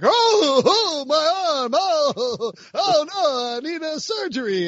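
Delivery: high, drawn-out comic wail of pain; Rodney Dangerfield. Acted. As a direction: moan in a high, hammed-up comedian's voice. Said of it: "You're Rodney Dangerfield?".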